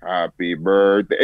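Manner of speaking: slow, low in-character greeting. Shown as a demonstration. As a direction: say it as the character, slowly. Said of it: "in character, right?".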